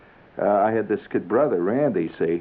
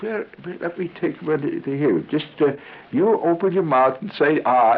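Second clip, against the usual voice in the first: higher gentle doctor voice; coaxing doctor; 6 semitones higher than usual. Retold or imitated. Doctor getting the boy to say Ah, higher and coaxing. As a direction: coax the kid like a doctor.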